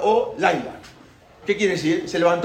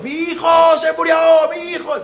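imitated screams; 10 semitones higher, 5 dB louder than usual. Parents crying out for their dead firstborn, wailing shout. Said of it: "cuando empezaron los gritos".

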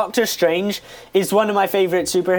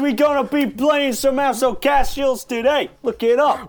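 put-on New York accent; 6 semitones higher than usual. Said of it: "Welcome to New York".

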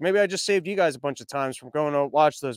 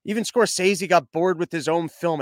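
ordinary talk, nothing marked

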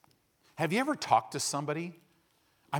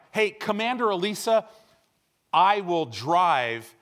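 mock insistent boast; 5 semitones higher, 7 dB louder than usual. Clueless pastor insisting to commander.

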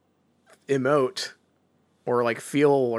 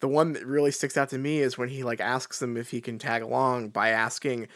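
usual speaking voice

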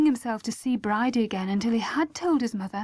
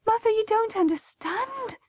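much higher, distressed plea; gasping; 12 semitones higher than usual. A narrator's reading. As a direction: gasp it out, desperate to be understood. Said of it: "gasped Bridie".

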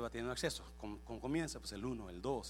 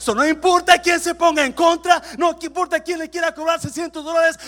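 full-force preaching; 23 dB louder than usual. Real, not acted. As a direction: cry it out to the whole congregation. (predicación a gritos)

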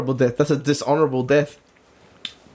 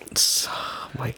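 softer, slow whispered disbelief; stunned whisper; 4 semitones lower, 7 dB quieter, slower than usual. Real, not acted. Listener learning it was the same night, stunned whisper. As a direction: whisper it slowly in shock. Shocked reaction to the story.

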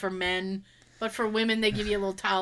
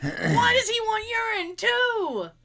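mock crying whine; 10 semitones higher, slower than usual. Complaining about the urine test.

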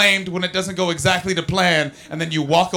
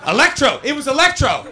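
mock eureka; 9 semitones higher, 5 dB louder than usual. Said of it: "Pretend like I just thought of that".

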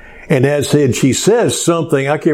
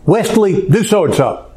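haughty command; 6 semitones higher than usual. Retold or imitated. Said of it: "does it in a haughty and commanding".

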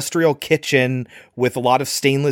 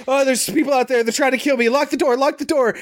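high, frantic plea for help; panicked; 11 semitones higher, faster than usual. Retold or imitated. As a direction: blurt it out in a panic, high-pitched. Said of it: "she says, like".

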